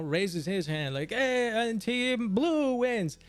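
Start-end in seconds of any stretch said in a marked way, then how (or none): 1.1-3.2 slow, higher mock referee call; lightly hammed-up referee; referee announcement. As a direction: announce the winner, stretching it out. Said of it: "the referee comes and".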